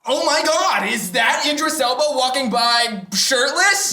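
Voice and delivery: loud, high put-on gasp of surprise; fake surprised exclaim. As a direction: oversell the shock to distract everyone. Fakes a distraction to escape.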